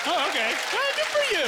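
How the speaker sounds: squeaky high, sing-song praise; mock cheerful. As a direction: congratulate them in a high squeak.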